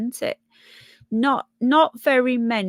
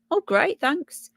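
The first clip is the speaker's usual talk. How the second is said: higher, mock-cheerful great; sarcastic thanks; 6 semitones higher than usual. Real, not acted. Reacting to the starvation prediction, sarcastic thanks. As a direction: fake delight at grim news.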